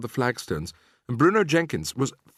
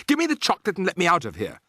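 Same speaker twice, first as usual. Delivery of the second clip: put-on fast, higher, pushy boy; suspicious demand; 7 semitones higher, faster than usual. A narrator's reading. Bruno suddenly suspicious of the witches, fast demand. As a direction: rattle it off, getting wary.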